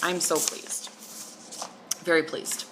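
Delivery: ordinary talk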